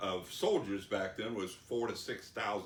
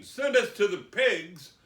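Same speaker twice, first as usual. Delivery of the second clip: loud, high, slow plea as the demons; acted demons' entreaty; begging; 8 semitones higher, 10 dB louder, slower than usual. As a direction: beg with drawn-out emphasis. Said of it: "So all the demons begged him".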